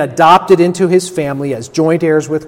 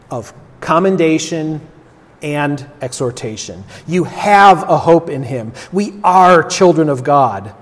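ordinary talk

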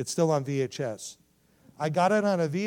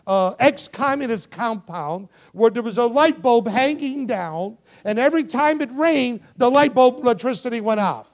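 loud, high-pitched preaching delivery; impassioned preaching; 8 semitones higher, 12 dB louder than usual. Acted. Preacher telling the congregation of the ex-communist compound, impassioned preaching. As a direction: preach it at full force.

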